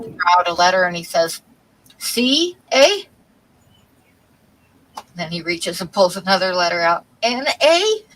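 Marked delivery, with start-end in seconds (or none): 2.0-3.1 "C, A?" with a high rise on "A"; questioning Canadian eh. Acted. Joke teller voices a guy reading letters, questioning eh. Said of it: "a lot of people from Canada that say A". 7.2-8.0 "N, A?" climbing high at the end; questioning Canadian eh. Acted. Joke teller reads the next letter, questioning eh. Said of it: "a lot of people from Canada that say A".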